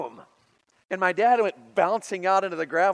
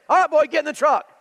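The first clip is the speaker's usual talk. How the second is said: loud, higher barked order; dad shouting; 10 semitones higher, 10 dB louder than usual. Retold or imitated. Angry father ordering his little boy, loud shout. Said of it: "and my dad says".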